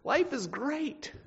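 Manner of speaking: imitating a cheerful Paul who is not fine; mock cheery. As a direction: sing it out breezily, tongue in cheek. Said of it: "he's saying".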